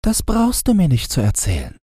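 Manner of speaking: high, fast and dismissive; a girl cutting the flower short; impatient girl. Acted. Gretchen cuts the lily short, high quick girlish voice.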